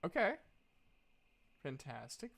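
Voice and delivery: ordinary delivery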